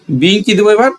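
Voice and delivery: normal talking voice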